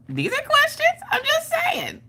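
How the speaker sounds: a high, sing-song feigned innocence; mock innocent squeak.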